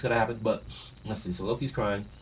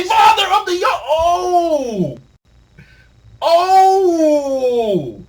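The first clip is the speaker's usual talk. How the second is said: much higher, loud stunned yelling; shocked shout; 11 dB louder, much slower than usual. Real, not acted. Reader hit by the Blackbeard reveal, shocked shout. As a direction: lose it at the reveal.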